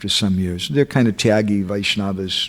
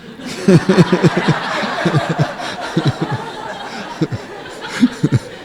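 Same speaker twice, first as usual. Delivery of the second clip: long, wheezing laugh; real, drawn-out amused laughter; long chuckle; 6 semitones higher than usual. Real, not acted. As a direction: laugh at your own punchline. Laughs at the story's punchline.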